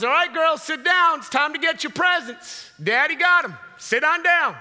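loud, high-pitched proud dad call; hearty announcing dad. Acted. Dad telling his girls it's present time, hearty announcing. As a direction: round up the kids with showy gusto.

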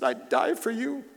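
strained, emotional declaration of devotion; tearful vow. Retold or imitated. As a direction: vow it to your newborn through tears. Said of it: "tears flowing down my cheeks".

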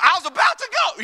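high, sheepish excuse voice; lightly voiced guilty churchgoer caught out; mock excuse. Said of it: "making excuses".

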